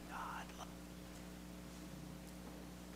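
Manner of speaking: barely audible whisper. Shown as a demonstration. Pastor showing children the message, hushed whisper. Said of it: "we're gonna whisper the message to them".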